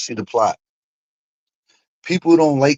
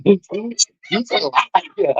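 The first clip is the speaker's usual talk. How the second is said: laughing drawl; 11 semitones higher, much slower than usual. Real, not acted. Laughing, repeating the accusation.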